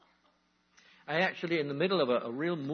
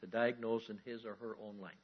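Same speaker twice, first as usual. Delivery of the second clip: ordinary talk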